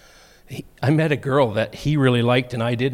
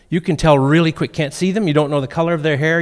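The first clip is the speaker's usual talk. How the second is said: normal talking voice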